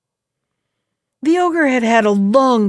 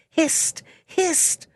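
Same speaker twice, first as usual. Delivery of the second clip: high hushed hissing call; 6 semitones higher, 4 dB quieter than usual. A narrator's reading. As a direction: hiss the warning high and soft. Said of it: "called out".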